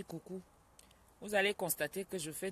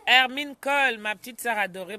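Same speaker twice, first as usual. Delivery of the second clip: much louder, higher hello; loud greeting; 4 semitones higher, 12 dB louder than usual. Real, not acted. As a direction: call out the name with delight. Greeting sister by name.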